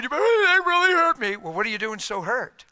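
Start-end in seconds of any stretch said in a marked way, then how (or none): 0.0-1.4 high, loud whine; whiny complainer. Retold or imitated. Preacher mocking a believer crying hurt, loud whine. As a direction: wail it like a wounded child.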